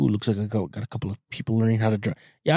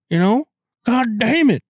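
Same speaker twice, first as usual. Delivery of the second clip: mock Southern accent; 11 semitones higher, 4 dB louder than usual. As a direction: play a riled-up Texan. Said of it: "develop a fucking accent".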